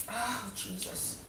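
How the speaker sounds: soft, slow pained groan; teary from chopping onions. Real, not acted. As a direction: wince through stinging eyes. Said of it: "my eyes are so watery".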